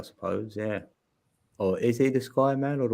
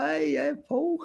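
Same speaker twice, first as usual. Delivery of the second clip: high, eager hey Paul; excited greeting; 13 semitones higher, 4 dB quieter, slower than usual. Retold or imitated. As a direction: call out a happy hello. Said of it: "I was like".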